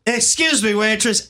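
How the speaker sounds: mock Gollum ordering at a diner. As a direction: call out in a put-on creature voice. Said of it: "I speak Gollum".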